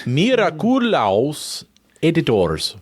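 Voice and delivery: slow Spanish-accented Miraculous editors. Shown as a demonstration. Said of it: "Is that Spanish for something?".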